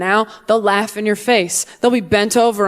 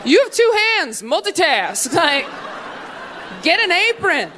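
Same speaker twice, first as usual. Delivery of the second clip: much higher, incredulous sarcasm; mocking retort; 10 semitones higher than usual. Comic replying to the newlywed, high sarcastic scoff. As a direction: scoff at her, pitched up. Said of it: "I'm like".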